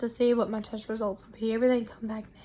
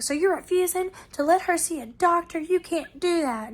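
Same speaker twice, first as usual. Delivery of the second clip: higher-pitched, indignant protest; retold argument, pitched up in disbelief; arguing sister; 6 semitones higher than usual. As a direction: imitate an outraged relative challenging the nurses. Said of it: "the sister was like".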